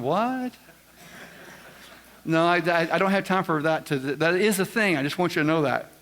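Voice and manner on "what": high, questioning "what?"; incredulous mock reaction; baffled audience. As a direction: voice the puzzled room, pitch jumping up. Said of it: "The rest of you are going".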